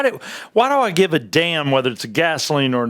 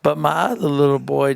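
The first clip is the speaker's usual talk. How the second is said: usual speaking voice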